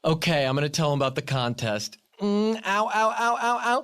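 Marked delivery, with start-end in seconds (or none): 2.2-3.8 yelping in pain; little cries of pain; pained ows. Real, not acted. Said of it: "clothespin on my tongue".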